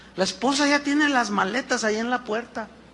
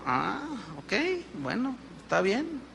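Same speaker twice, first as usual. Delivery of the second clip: grudging agreement; 10 dB quieter than usual. Acted. People grudgingly agree with God.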